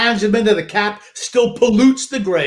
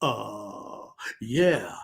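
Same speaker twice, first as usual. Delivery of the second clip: lower, much softer hammed-up hesitation; dramatic pause; 8 semitones lower, 11 dB quieter than usual. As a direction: drag out a mock dramatic pause. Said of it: "that big dramatic pause".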